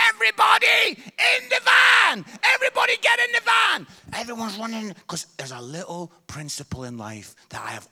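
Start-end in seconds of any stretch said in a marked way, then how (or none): 0.1-3.9 high, loud urgent yell; shouting orders. Retold or imitated. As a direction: bark the order to get in the van. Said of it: "John starts shouting".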